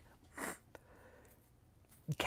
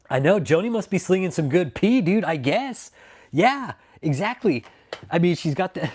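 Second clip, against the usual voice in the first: higher, louder gleeful mockery; excited; 7 semitones higher, 5 dB louder than usual. Real, not acted. Streamer gleefully mocks Joni to chat. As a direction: crow it out with glee.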